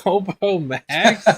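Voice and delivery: high, slow, laughing question; laughing disbelief. Real, not acted. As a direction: crack up asking it. Amused disbelief at nickname.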